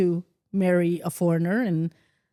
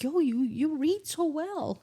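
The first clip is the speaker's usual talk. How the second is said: impressed classmates; 8 semitones higher, 4 dB quieter, slower than usual. Retold or imitated. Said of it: "and they were like".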